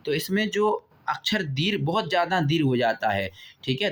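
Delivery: normal talking voice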